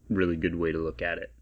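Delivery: normal talking voice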